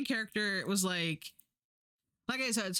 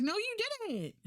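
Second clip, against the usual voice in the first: much higher, incredulous no you didn't; exasperated retort; 12 semitones higher than usual. Retold or imitated. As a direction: fire it back at the lying narrator. Retorting to the book's character.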